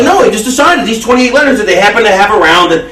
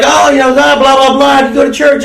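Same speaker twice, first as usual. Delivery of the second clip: higher-pitched mock convert pitch; lightly mimicked churchgoing lady; 7 semitones higher than usual. Lady trying to convert a salon customer, lightly mimicked. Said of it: "some lady tried to convert me".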